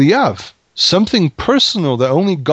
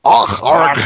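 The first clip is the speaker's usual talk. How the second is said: mock pirate "Ach, arg" with a Scottish edge, louder; pirate growl; 4 dB louder than usual. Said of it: "a bit of pirate language going on there".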